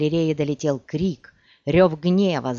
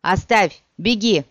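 loud, raised commands; urgent shouted plea; 3 semitones higher, 5 dB louder than usual. A narrator's reading. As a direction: urge her to get away. Urging archer to flee.

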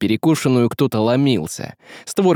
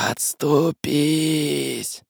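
very slow, eerie whispery creature voice; rustling shadow; 3 semitones higher, 4 dB quieter, much slower than usual. Acted. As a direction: draw it out like rustling leaves. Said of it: "Прошелестело".

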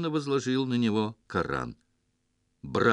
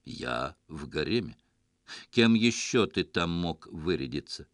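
said through a fixed smile; smiling; 6 semitones lower than usual. A narrator's reading. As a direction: keep smiling as you answer. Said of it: "не снимая улыбки".

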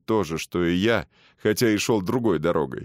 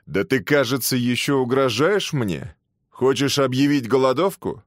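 higher, sneering jailer's threat; stern warden; 5 semitones higher, 3 dB louder than usual. Acted. Prison warden threatens prisoner.

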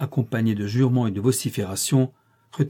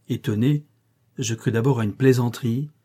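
plain reading voice

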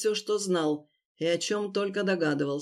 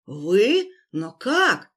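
shocked; 6 dB louder, much slower than usual. Acted. Captive shocked at rescuer.

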